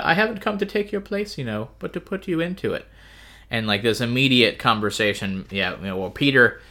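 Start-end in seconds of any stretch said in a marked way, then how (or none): 0.0-2.9 high-pitched hammed-up aristocrat; mock upper-crust royal, softening at the end; Peter's aristocratic voice. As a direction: play a lordly noble, pitched well up. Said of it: "That's my aristocratic Peter voice".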